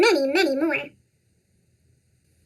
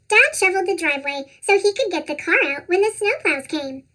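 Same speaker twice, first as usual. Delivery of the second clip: ordinary delivery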